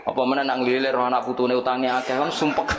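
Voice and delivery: normal talking voice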